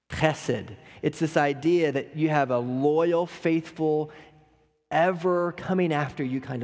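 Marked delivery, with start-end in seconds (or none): 0.1-0.8 chesed with a throaty Hebrew ch, stress on the first syllable; Hebrew word chesed. Shown as a demonstration. Preacher teaching the Hebrew word: chesed with guttural ch. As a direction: say chesed slowly, guttural ch then esed. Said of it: "the Hebrew word chesed".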